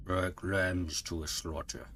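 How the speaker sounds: slow, lightly voiced villain line; a hint of a cold robot villain; imitating his voice. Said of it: "let me see if I can do his voice here".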